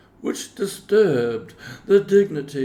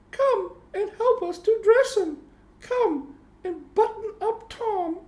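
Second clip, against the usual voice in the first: much higher, playful little kitten. A narrator's reading. Moppet urging her siblings up the wall, high kitten voice. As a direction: squeak it like an eager kitten. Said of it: "said Moppet".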